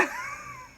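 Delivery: shrill giggle; squeaky laugh; high burst of laughter. Real, not acted. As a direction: laugh at yourself. Laughing at her packed suitcase.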